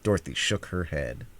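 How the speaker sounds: ordinary delivery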